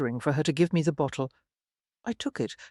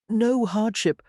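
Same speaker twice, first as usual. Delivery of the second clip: brisk, higher-pitched British voice; reply like a brisk Briton; crisp British accent; 6 semitones higher, 3 dB louder, slower than usual. A narrator's reading. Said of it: "in a brisk British accent".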